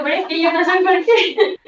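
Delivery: high, loud mock protest tipping into laughter; laughing protest. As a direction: retell your own baffled retort, loud and laughing. Retold retort, breaks into laughter.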